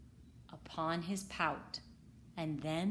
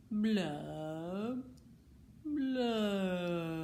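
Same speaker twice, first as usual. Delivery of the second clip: glum fish blubs. Acted. Glum bubbling refrain.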